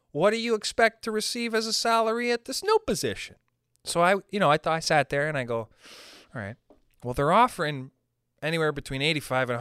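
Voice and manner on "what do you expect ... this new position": lightly voiced prim interviewer, a bit higher; mock interviewer. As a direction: read the question like a stiff HR form. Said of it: "they go".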